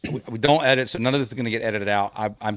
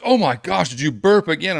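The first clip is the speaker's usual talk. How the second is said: raised, louder mock outcry of disgust; exasperated mock outcry; 7 semitones higher, 5 dB louder than usual. Imitating his wife catching him burping again, higher and louder. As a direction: exaggerate her exasperation. Wife's second, louder reaction.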